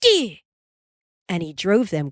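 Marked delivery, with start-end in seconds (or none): 0.0-0.4 high, short driving shout; high-pitched holler to the calves; shouted command. A narrator's reading. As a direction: call out sharply to turn the team. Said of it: "cracking his whip and shouting".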